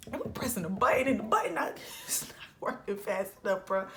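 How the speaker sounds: breathy, unvoiced hurried telling; hushed, laughing panic; frantic whispering. Frantic at the elevator button.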